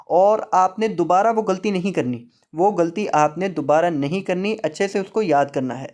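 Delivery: ordinary talk, nothing marked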